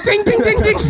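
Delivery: very high, ringing "ting ting ting"; imitated jar clinking. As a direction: mimic little glass jars clinking. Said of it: "them little jars was like".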